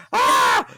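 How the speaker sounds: loud exaggerated "ah"; hammed-up panic yelp; mock panicked cry. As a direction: burst out in mock alarm. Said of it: "All of these different things".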